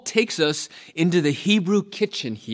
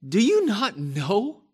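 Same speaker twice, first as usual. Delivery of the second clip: higher, slow exasperated question; frustrated rebuke; 7 semitones higher than usual. Acted. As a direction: plead in frustration. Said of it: "you can hear the frustration in the Apostle Paul's voice".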